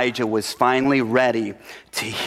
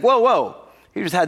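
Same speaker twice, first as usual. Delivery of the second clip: surprised whoa; 7 semitones higher than usual. Acted. God stopping Elijah's repeated answer, surprised. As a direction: cut in with disbelief.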